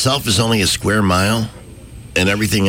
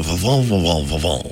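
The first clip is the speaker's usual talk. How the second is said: wrinkled-nose French gibberish; mock French; 3 semitones lower than usual. Shown as a demonstration. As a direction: repeat "fo" through a wrinkled nose. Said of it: "wrinkle up your nose a little bit and go".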